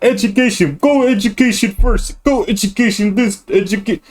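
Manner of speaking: high-pitched parody voice; mocking naggers. Complaining that all they care about is education, mocking. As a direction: mimic the pushers in a high, put-on chant.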